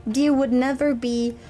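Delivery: ordinary talk, nothing marked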